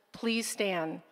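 usual speaking voice

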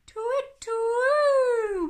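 high, loud, long twit-twoo; owl hoot. Acted. Owl calls in the night garden, high loud hoot. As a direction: hoot out with a stretched second note. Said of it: "went the owl".

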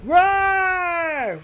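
high loud playful roar; monster roar. Pretend. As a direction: roar like a monster to scare them. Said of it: "Were you scared?".

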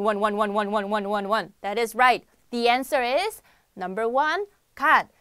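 ordinary talk, nothing marked

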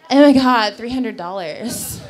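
lightly voiced ditzy stripper, slow on the price; a hint of airhead, drawing out the number; mock bimbo voice quoting a price; stupid stripper voice. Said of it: "in her stupid stripper voice".

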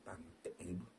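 whispered curse under breath. Real, not acted. Streamer cursing quietly to himself, whispered. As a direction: hiss it quietly.

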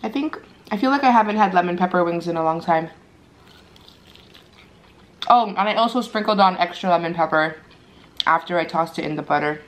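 chewing between words; talking around a mouthful of food; mouth full while talking. Real, not acted. Seen 1: Chewing before speech, bites wing at 3.3, keeps chewing while talking.

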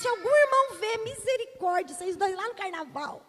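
a mother panicking that church folk will see; hissed alarm from a ducking mom. Retold or imitated. Mother fears church seeing them.

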